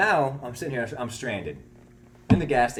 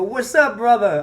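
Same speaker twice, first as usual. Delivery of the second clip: drawn-out, much higher, louder friendly greeting; imitating the store owner; 11 semitones higher, 12 dB louder, slower than usual. Store owner greets the narrator as a buddy, hammed-up high call. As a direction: stretch out a loud, high hello to an old pal. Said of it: "That's how he always talks to me".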